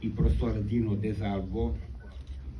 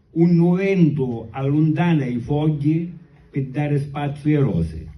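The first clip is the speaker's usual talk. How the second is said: slow declaimed poem in Sicilian; sicilian accent; 4 semitones higher, 8 dB louder than usual. Acted. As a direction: recite the verses slowly in Sicilian. Recites his Sicilian poem.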